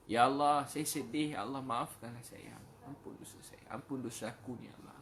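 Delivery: acted plea for forgiveness, sinking into near-whisper; tearful prayer. Praying to Allah for forgiveness, acted tearful pleading that fades. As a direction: begin with a pleading cry, then fade to a murmur. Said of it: "pernah menangis doa".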